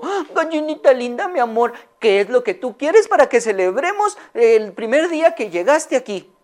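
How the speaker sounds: high, sweet voice doting on her hen; loving owner. Acted. Señora Amelia sweetly asks her hen, high and tender. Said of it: "le dijo".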